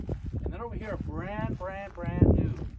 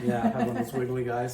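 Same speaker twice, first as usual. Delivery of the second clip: laughing; 5 semitones lower, 3 dB louder than usual. Real, not acted. Joking about wiggly guy ads.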